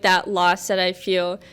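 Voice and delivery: ordinary delivery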